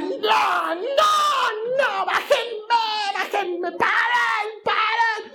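high, drawn-out mock wail; imitated child screaming. Imitating daughter begging to get off the ride, screaming. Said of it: "y empieza a gritar".

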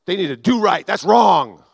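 loud indignant scolding, building to a shout; judgmental prophetic person. Retold or imitated. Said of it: "A prophetic person may walk by and said".

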